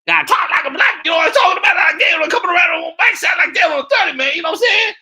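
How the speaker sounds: very high, loud, rapid caricature; frantic high-pitched parody rant; Jasmine. Shown as a demonstration. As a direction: shout it fast at the top of your range. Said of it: "when I do Kamala and Jasmine, Jasmine".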